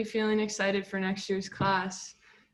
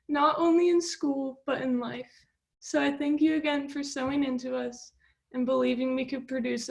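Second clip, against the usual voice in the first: higher voice, close to crying; tearful; 5 semitones higher than usual. Real, not acted. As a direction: speak through tears, emotional. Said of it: "I'm so mad I'm crying".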